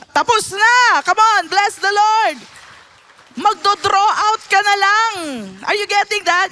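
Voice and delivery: loud, high fervent exclamations; full-force preaching; preaching shout. Real, not acted. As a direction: rouse the congregation.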